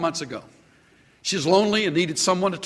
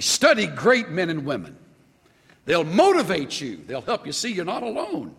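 ordinary delivery

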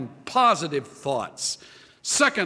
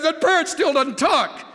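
complaining widow; 11 semitones higher, 4 dB louder than usual. Retold or imitated. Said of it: "She says".

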